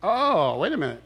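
ordinary talk, nothing marked

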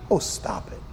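whispered scoff; breathy dismissive aside. Real, not acted. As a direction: wave it off under your breath. Scoffing at the objection.

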